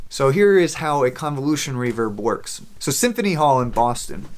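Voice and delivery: normal talking voice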